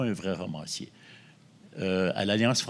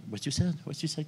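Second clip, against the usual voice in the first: put-on Québécois accent in English; 8 semitones higher, faster than usual. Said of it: "avec mon fort accent québécois".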